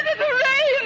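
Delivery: high, loud hooray; lady cheering their escape; joyful cheer. Acted. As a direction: cheer with the boatman. Celebrating passing the fort.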